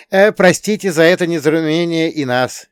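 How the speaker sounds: high, loud shout back; yelled apology. A narrator's reading. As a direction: yell it back over the shoulder. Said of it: "проорал я в ответ".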